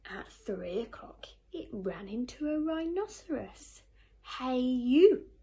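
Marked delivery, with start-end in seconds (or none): 4.4-5.3 very loud, pushy call; bad-tempered hail. A narrator's reading. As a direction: bellow a challenge at a huge opponent. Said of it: "said the bad-tempered ladybird".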